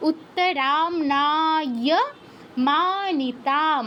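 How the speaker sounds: উত্তরামনায় মানিতাম spoken very slowly with long vowels; slow pronunciation demo. Shown as a demonstration. Teacher modelling long vowels for the class, very slow. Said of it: "রাতে দীর্ঘ".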